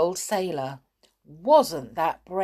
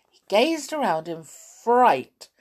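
usual speaking voice

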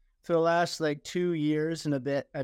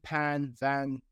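ordinary talk

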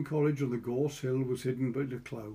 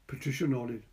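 ordinary delivery

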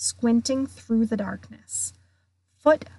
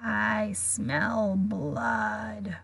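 very slow, softer murderous drawl; cold monster voice; 5 dB quieter, much slower than usual. A narrator's reading. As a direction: savour each word, far off and fading. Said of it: "same cold, murderous voice".

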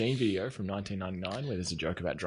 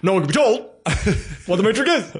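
Morpheus quote; 8 semitones higher, 4 dB louder, faster than usual. Pretend. Quoting Morpheus line.

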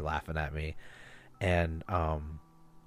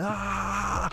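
mock scream; 13 semitones higher than usual. Retelling a panic attack in the store, mock scream. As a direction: imitate a long scream without raising the volume. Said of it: "I felt like I was screaming".

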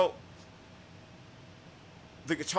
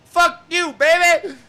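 high, loud rock-singer shout; imitating the singer; 13 dB louder, slower than usual. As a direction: belt it like the band's frontman. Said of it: "and then he goes".

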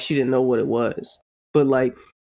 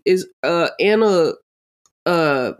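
ordinary talk, nothing marked

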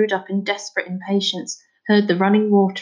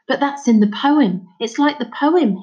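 put-on higher, eager boy cutting in; interrupting; 5 semitones higher, 4 dB louder than usual. A narrator's reading. Boy interrupting his father about the poem, excited. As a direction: burst in with a sudden realisation. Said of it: "he interrupted".

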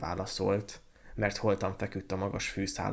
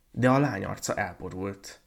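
ordinary delivery, nothing marked

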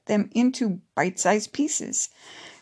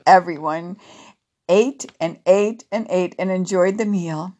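ordinary delivery, nothing marked

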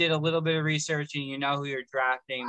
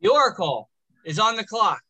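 draft announcer; 5 semitones higher, 4 dB louder than usual. Acted.

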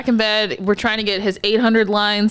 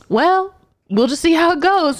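higher-pitched imitation of a nurse; mimicked noncommittal nurse; 7 semitones higher than usual. Nurse putting off a worried therapist, mimicked noncommittal reply. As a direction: brush it off in a higher voice.

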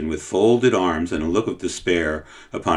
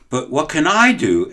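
higher, helpless perplexed question; 8 semitones higher, 4 dB louder than usual. Acted. Cook asks the boy helplessly, perplexed. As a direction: at a loss, plead it. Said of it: "asked the cook in perplexity".

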